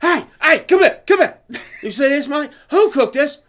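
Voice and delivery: high, loud summons; hammed-up shouted interrogation; mock chef yelling. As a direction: yell the cook over like an angry chef. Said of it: "you're yelling at him".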